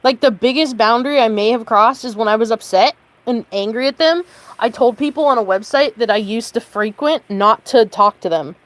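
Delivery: fuming, every line pushed hard; heated rant; sustained angry rant. Real, not acted. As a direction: keep venting the anger. Angry rant held loud.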